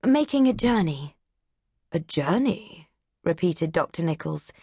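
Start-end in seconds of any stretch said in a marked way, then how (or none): 1.9-2.9 word savoured with distaste. Acted. Doctor Nichols repeats Mary's word with mild distaste. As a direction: roll journey round the mouth as if it tastes bad. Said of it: "He rolled the word around in his mouth".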